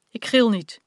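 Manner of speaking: loud, high shout of denial. A narrator's reading. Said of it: "riep Janna". (hoog en luid terugroepen)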